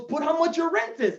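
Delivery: high-pitched helpful prompt. Acted.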